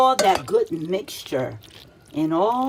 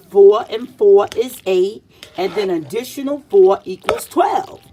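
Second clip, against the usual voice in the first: teacherly loud count; 8 dB louder than usual. Acted. Quizzing kids on arithmetic.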